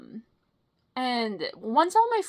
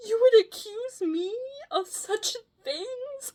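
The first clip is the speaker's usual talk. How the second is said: high, affected offended voice; mock innocence; 10 semitones higher than usual. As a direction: act falsely wounded. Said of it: "They're still looking at me like".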